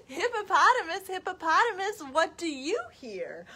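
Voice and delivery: high, loud sing-song animal call; reading to children. Acted. Storytime question and answer about a hippo, lilting and dramatic. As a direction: chant the question brightly upward, then stretch the answer. Reads picture book to children.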